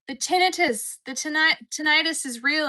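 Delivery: loud, high mock proclamation; meme impression. Streamer quoting the chick in a meme, loud and high. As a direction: belt it out like the meme. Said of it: "where the chick's just like".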